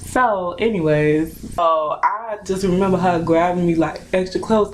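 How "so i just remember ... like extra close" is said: ordinary delivery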